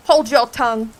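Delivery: loud sharp rebuke; snapping order. Acted. Griffin cutting Alice off, a loud snapped order. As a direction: shout her down.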